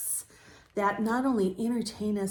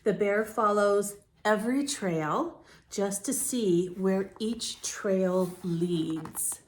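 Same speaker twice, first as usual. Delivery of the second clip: slow, gentle read-aloud; story narration; slower than usual. A narrator's reading. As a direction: read it calmly to young listeners. Reads picture book to children.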